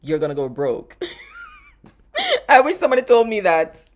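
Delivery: normal talking voice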